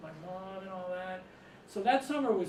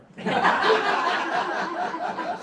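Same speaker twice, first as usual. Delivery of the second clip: high, loud burst of laughter; real roaring laughter; big laugh; 14 semitones higher, 12 dB louder than usual. Real, not acted. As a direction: crack up at the punchline. Laughter after the punchline.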